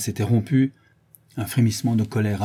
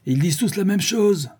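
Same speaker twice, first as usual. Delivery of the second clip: high-pitched cry above the uproar; shouted accusation; 10 semitones higher, 3 dB louder than usual. A narrator's reading. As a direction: raise your voice over every other. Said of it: "cria-t-il". (cri au-dessus du tumulte)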